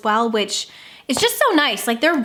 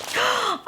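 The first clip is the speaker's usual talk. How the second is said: soft gasp; 9 semitones higher, 6 dB quieter than usual. Real, not acted. Sees the next gift.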